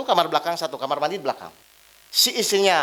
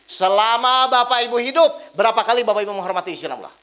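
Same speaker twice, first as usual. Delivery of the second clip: insistent repeated question. Real, not acted. Preacher rousing silent audience, insistent projected question. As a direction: call it out to rouse the room.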